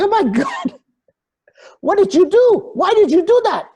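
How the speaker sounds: retelling a panicked dad's high, loud outcry; shocked father. Retold or imitated. Father finds cameras in water.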